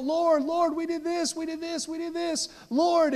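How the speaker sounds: high-pitched, repeated desperate plea; crying out. Retold or imitated. Preacher imitates people pleading on judgment day, crying out. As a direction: plead urgently on one high note, imitating the condemned. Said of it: "They're crying out".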